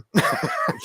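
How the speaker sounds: short squeaky laugh of disbelief; high-pitched laugh. Real, not acted. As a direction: let out a quick high laugh. Laughs while stuck for an answer.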